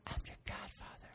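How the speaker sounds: hushed reveal; whispered godfather line. Real, not acted. Uncle reveals a secret to child.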